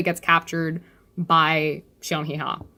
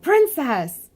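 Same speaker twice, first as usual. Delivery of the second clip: a mild alarmed cry, higher; freaking out; 7 semitones higher than usual. Retold or imitated. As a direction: call out to her in light panic. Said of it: "Evan's freaking out".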